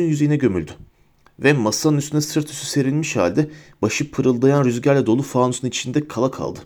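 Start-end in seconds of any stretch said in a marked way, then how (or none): none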